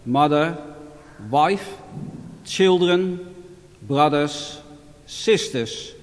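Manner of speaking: measured solemn scripture reading; Gospel reading. Acted. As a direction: read it like a priest at Mass. Formal Gospel reading at Mass.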